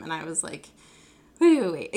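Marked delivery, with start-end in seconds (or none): 1.4-1.9 loud, raised "wait, wait"; mock startled stop; retold interruption. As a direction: cut in to halt him, acted out. Retold startled halt to partner.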